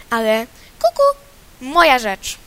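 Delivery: loud, defiant scolding; angry cuckoo. Acted. Cuckoo scolding the rooster, loud and defiant. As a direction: stand your ground and yell at him. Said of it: "wrzaśnie".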